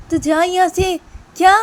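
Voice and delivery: sharp high yell telling him to leave; angry shout. Acted. Sister yelling at her brother to get out.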